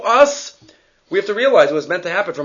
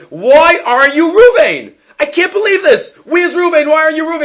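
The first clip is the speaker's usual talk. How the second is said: mock screaming; 10 semitones higher, 4 dB louder than usual. Guy in the parable screaming at strangers, loud mock yell. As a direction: yell it like a man gone a little off. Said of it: "So I start screaming at them".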